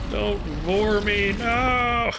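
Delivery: high, drawn-out hammed-up wail; mock protest.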